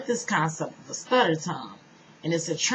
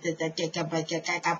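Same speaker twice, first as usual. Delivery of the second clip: imitated stutter; faster than usual. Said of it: "it was a stutter, really bad".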